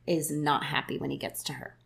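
normal talking voice

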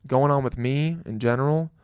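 normal talking voice

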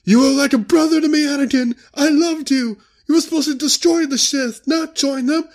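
hammed-up anguished lament; Obi-Wan impression. Obi-Wan mourning his fallen brother Anakin, in a squeaky hammed-up lament. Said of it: "Obi-Wan's like".

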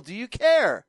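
usual speaking voice

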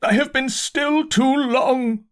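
high, slow, far-carrying proclamation; loud declaiming. A narrator's reading. Curate defying pleas for silence, loud high declaiming. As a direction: cry it out so it reaches the pit. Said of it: "in a tone that must have reached the pit".

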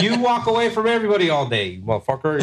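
high, fast, jokey call-out; laughing teasing accusation. Real, not acted. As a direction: rib your friend hard, half laughing.

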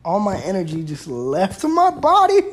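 ordinary talk, nothing marked

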